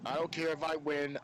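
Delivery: ordinary talk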